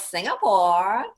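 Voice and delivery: slow, drawling ditzy puzzlement; sorority girl voice. Acted. Sorority girl mistaking a country for a shop, slow ditzy voice. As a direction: draw it out, rising, totally clueless. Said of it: "I have a sorority girl voice".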